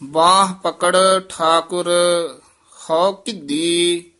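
slow measured chant of scripture; scripture recitation. Acted. Teacher recites Gurbani for students, in a measured chant. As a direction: recite each line with devotion.

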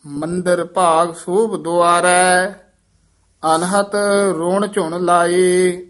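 slow, measured Gurbani recitation; scripture recitation. Acted. Teacher reciting Gurbani for learners, measured chant-like reading. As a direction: hold the long vowels, pausing between phrases.

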